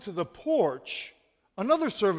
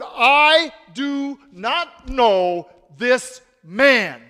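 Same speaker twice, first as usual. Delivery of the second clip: loud, very slow shouted denial; 3 semitones higher, 11 dB louder, much slower than usual. A narrator's reading. Peter shouting his denial at the bystanders, loud and slow. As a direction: shout it word by word, desperate. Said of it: "Peter began to curse".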